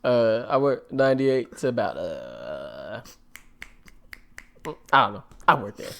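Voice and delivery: put-on creaky old man storyteller voice; grandpa voice. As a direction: play a rambling old grandpa. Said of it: "Your grandpa voice is so much more different than mine".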